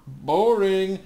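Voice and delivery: loud, high mock-bored groan; bored heckle. Real, not acted. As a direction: cut her off with a blunt jeer. Player mocks the long speech.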